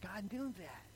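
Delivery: awed whisper of gratitude; moved. Retold or imitated. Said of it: "And I just began to weep".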